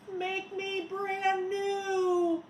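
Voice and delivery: high, slow, tearful plea; pleading puddle voice. A narrator's reading. Melted snowman pleading from a puddle, tearful. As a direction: beg from the puddle, half crying.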